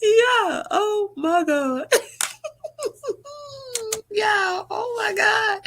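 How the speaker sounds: high, teary laugh-cry; real laugh-crying, voice breaking high; crying with laughter. Real, not acted. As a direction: laugh till the tears come. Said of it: "Y'all about to cry real tears".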